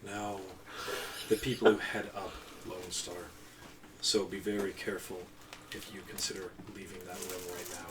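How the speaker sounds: low, quiet, threatening employer; menacing client voice. Acted. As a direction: speak low and warn them. GM voicing the Johnson.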